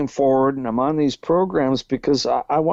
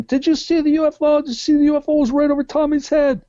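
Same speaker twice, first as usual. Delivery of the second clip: put-on higher, fast kids shouting excitedly; retold excited yelling; 13 semitones higher, faster than usual. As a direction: blurt it out like wound-up kids. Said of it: "they go screaming".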